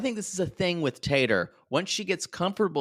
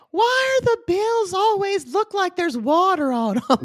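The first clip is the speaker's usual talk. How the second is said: very high, squawky mock complaint; imitating Tater; 4 dB louder than usual. Host imagines Tater finding bills, high caricatured voice. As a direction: put on her shrieky high-pitched voice. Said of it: "I wanna see Tater saying".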